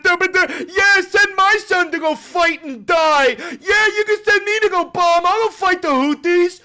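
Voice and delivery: high, loud hammed-up cheering yell; mock cheering supporter. Mocking Trump's supporters cheering for war, a loud hammed-up yell. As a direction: parody a dumb cheering fan. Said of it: "supporters cheer for it".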